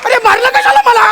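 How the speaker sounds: high-pitched indignant yelp; acted outraged complaint; startled protest. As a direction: cry out at being hit, pitch shooting way up. Protests at being hit.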